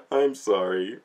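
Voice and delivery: ordinary talk, nothing marked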